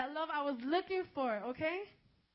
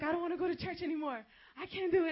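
louder, fed-up voice of the guy; exasperated refusal; 4 semitones higher, 7 dB louder than usual. Retold or imitated. The guy quitting church, voiced louder and fed up. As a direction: push back, done with it all. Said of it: "he's like".

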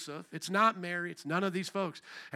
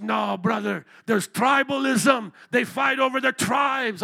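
put-on loud, slow, lightly Kenyan-accented reply; preacher voicing the Kenyan brother's answer; Kenyan accent attempt; 3 semitones higher, 8 dB louder, slower than usual. A hint of a Kenyan accent, slow and loud. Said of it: "I'm trying to do an accent".

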